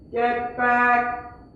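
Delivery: loud, high, drawn-out shout; yell. Acted. Teacher acting the man calling to a swimmer, yelling. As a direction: yell it out like calling across water. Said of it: "he's gonna yell".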